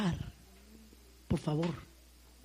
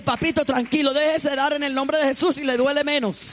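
loud, higher, coaxing voice; reassuring call; 6 semitones higher, 6 dB louder than usual. Retold or imitated. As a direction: coax him loudly to let go. Calming a man during deliverance. (voz alta y persuasiva)

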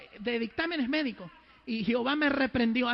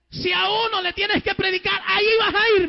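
high, loud preacher's order; forceful, raised retold order; retold divine command; 8 semitones higher, 9 dB louder than usual. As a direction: command it at full voice.

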